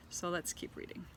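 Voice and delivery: usual speaking voice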